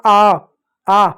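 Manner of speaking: आ held long and open; drawn aa sound. Shown as a demonstration. Teacher demonstrating the aa vowel, long आ. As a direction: sing out a long आ for the class. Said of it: "आ की मात्रा का उच्चारण".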